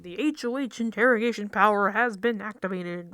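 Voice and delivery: loud, emphatic alarm bulletin; mock announcement. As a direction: announce it over the sirens, mock-urgent. Mimics the game's alarm announcement.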